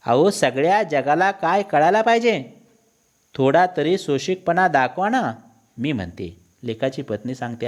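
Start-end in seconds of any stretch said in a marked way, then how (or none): 0.1-5.3 higher, mildly exasperated appeal; lightly acted wifely reproach; scolding wife. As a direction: urge the husband to bear up. Wife scolds husband in acted quarrel.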